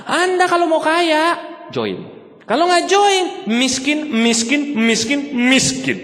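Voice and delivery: pointing and ranting, join or stay poor; lampooning a pushy pitch, voice up high; mock ranting speaker. Seminar speaker mocked for ranting at the audience, shrill hammed-up rant.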